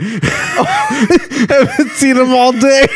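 high, slow, laughed-out words; helpless laughter. Real, not acted. Repeating the co-host's joke, cracking up. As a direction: squeeze the line out through laughter.

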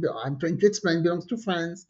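ordinary delivery

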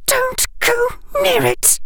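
put-on very high, frightened girl's voice; terrified warning. A narrator's reading. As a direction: warn him in high-pitched terror. Terrified girl warns Harry.

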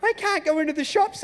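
put-on high, whiny little-girl voice; imitating his daughter. Dad mimics his daughter refusing to go into the shops, high and whiny. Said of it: "she just looks at me and goes".

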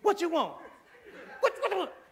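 put-on high, loud exasperated boy what you want; annoyed parent. Father interrupted in prayer snapping at his son, high and loud. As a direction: shoo the kid off, irritated and laughing. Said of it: "you're like".

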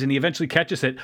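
normal talking voice

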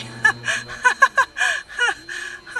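high breathy laugh breaking into a cry; laugh cracking into a sob. Acted. Lone survivor laughing close to tears. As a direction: laugh that nearly turns to tears. Laugh tipping toward crying.

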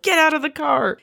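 high, hammed-up angry yell; mock fit. Mario yelling at the dog in his Porsche, mock angry. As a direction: imitate a man throwing a fit over his car. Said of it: "he has a fit".

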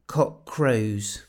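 usual speaking voice

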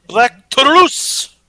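slow, drawn-out hype announcement; ring announcer. Pretend. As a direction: stretch the name like a ring announcer. Announcing wrestler name, hammed.

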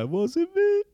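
high, soft mock-innocent whimper; innocent act. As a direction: play dumb in a small whiny voice. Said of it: "I'd be like".

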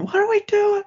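mock falsetto panic; high-pitched falsetto. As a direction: whine it in a cartoonish falsetto. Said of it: "high-pitched falsetto [name] going".

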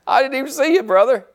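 high, startled hello; surprised greeting. Retold or imitated. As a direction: act caught off guard. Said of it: "I told him, I said".

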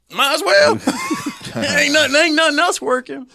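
high, loud, laughing defeat; patient agreeing to a prayer, laughing and resigned. Retold or imitated. Giving up, agreeing to pray.

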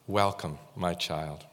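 soft low tender welcome. Pretend. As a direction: say welcome my child gently, as God. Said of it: "he says".

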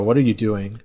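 ordinary talk, nothing marked

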